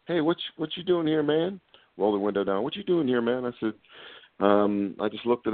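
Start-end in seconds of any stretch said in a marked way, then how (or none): 0.1-1.6 higher, mock-challenging deputy voice; a suspicious lawman voiced a bit higher; imitating a deputy. Deputy pulls up and questions the narrator, mock-challenging and higher. 2.6-3.4 higher, mock-challenging deputy voice; a suspicious lawman voiced a bit higher; imitating a deputy. Deputy pulls up and questions the narrator, mock-challenging and higher.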